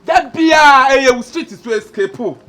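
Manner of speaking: high, loud opening outburst; angry shout. Acted. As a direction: shout the first words, then ease off. Repeats the line, now shouting.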